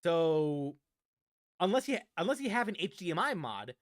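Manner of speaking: ordinary talk, nothing marked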